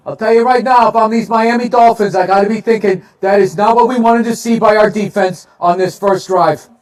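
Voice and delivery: loud. Real, not acted. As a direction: call it like a broadcaster. Pumped mock commentary.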